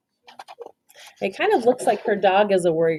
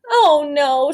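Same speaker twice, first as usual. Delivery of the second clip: groaned; 7 semitones higher, 4 dB louder than usual. Acted. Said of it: "she groaned to herself".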